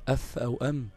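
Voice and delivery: أث and أم short and effortless; easy-exit letters. Shown as a demonstration. Teacher showing students easy articulation, أث and أم said short and light. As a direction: say أث and أم lightly, no lengthening. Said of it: "سهلة خالص، سهلة الخروج".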